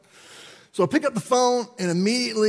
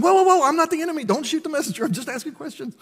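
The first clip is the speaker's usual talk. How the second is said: raised, fast whoa-whoa protest; defensive protest; 7 semitones higher than usual. Retold or imitated. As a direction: back off, I'm on your side. Defending himself to the leader.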